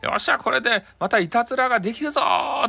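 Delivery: high, delighted whoop of a prankster; gleeful cheer. Acted. Hikoichi cheering that he is invisible, gleeful and high. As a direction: crow with glee at the coming mischief.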